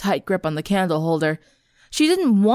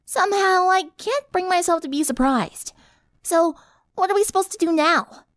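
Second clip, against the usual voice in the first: exasperated tomboy; 10 semitones higher than usual. A narrator's reading. Rainbow voiced.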